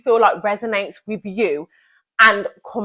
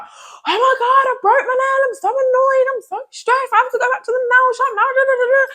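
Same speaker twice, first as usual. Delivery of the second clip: much higher, whiny mock-dramatic voice; squeaky hammed-up self-imitation; dramatic high-pitched whine. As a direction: overblown panic over a broken nail. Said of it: "I used to break a nail and be like".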